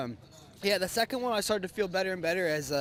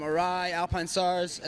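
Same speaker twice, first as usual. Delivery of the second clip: ordinary talk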